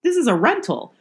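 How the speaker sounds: playfully horrified, as if the house were yours; mock alarm. Host reacting to gunfire into the ceiling, mock horrified. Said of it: "but I was like".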